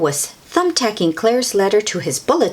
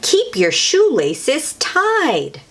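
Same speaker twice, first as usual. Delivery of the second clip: slow, deliberate, higher lesson tone; slow instructive; 7 semitones higher, much slower than usual. Acted. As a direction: spell it out like a rule. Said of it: "gave safety tip number one".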